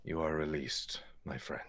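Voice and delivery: hushed, low farewell; solemn gentle character. Acted. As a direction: release them softly, almost whispering. In-character release of the fae.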